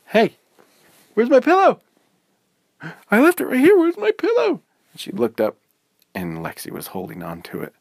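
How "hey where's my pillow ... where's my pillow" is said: very high, loud outraged questions; indignant hey. Acted. Izzy finding her pillow gone, high and loud. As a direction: demand where it went. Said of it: "Izzy was like".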